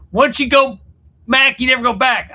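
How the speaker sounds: "Mac" and "back" said high and loud; loud, high corrected rhyme; corrected saying. Shown as a demonstration. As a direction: hammer home the right version. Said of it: "corrects the saying".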